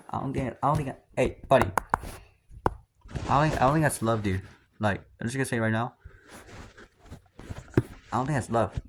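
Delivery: telling a friend his mindset is wrong, loud rant. Real, not acted. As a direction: tell the friend off loudly. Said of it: "I know I'm ranting".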